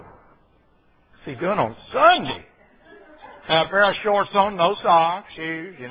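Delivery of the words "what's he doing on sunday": slow, scandalized neighbor voice; drawn-out gossiping "What's he doing on Sunday?"; mock nosy churchgoer. Mocking churchgoers watching him mow.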